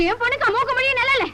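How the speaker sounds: indignant retort. Acted. Women's quarrel, indignant retort.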